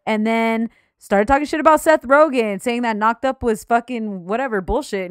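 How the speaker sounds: ordinary talk, nothing marked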